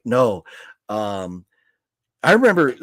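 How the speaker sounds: usual speaking voice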